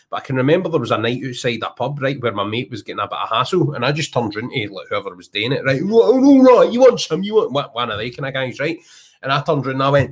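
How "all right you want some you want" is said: loud high challenge, picking a fight; confrontational shout. Retold or imitated. To a guy hassling his mate, aggressive shouted challenge. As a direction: bark it like you're squaring up. Squaring up to a pub troublemaker.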